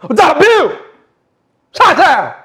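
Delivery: high, very loud yelling; full shout. Acted. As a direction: yell every phrase at full volume. Shouted outburst in acted scene.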